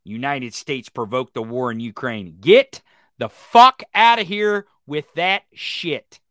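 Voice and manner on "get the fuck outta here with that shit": slow, louder, each word hammered; slow furious snap; angry dismissal. Real, not acted. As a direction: drag it out in disgust.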